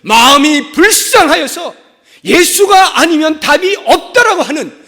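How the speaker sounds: loud, high-pitched pulpit proclamation; full-force preaching. Acted. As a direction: build to a shouted sermon climax. (목청 높여 외치는 설교)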